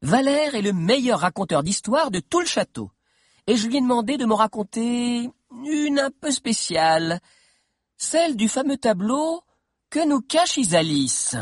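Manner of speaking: put-on high-pitched girl addressing her gang; teasing, showy introduction in a high voice; Sabi presenting Valère. A narrator's reading. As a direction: build intrigue, stretching the last words.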